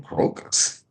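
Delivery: breathy whisper under laughter. Real, not acted. Host repeating the funny word in a whisper.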